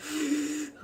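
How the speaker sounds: demonstrated gasp for air; imitated struggling gasp. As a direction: act out trying to suck in a breath. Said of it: "like, trying to breathe and stuff".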